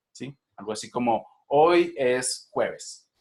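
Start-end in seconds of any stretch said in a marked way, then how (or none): 1.5-3.1 HOY ES JUEVES very slow and even; flat statement intonation. Shown as a demonstration. Teacher demonstrating a plain affirmation, flat intonation. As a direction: drag out "hoy es jueves" with level stress. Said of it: "una entonación plana".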